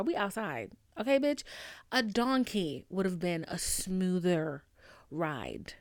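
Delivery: normal talking voice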